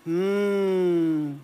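relaxed pleasurable hum; comfortable hum. Shown as a demonstration. Coach demonstrating a cosy hum for the audience. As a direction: hum as if thinking of dinner. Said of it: "wohliges Summen".

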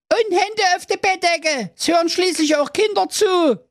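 very high, prim motherly warning; tut-tut like a prudish queen; high scolding mother. Acted. Queen admonishes daughter.

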